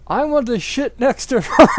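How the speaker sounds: high, loud mock shout; hammed-up yell. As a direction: bellow it as a demand. Quarrel at the table.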